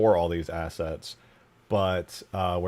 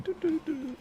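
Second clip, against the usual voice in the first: high little synth "doo doo doo"; imitated beeps; 5 dB quieter than usual. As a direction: mimic a hologram button chiming, high and light. Imitates the button press sound.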